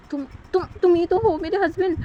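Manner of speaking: stammering then blurting out in disbelief; exasperated protest. A narrator's reading.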